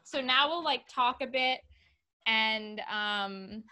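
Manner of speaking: host announcement. Real, not acted.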